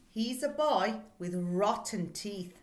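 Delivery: ordinary delivery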